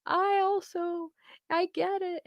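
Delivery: over-the-top tearful sympathy, half joking; mock bawling. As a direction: pretend to blubber along with the client. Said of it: "if I started bawling too and saying".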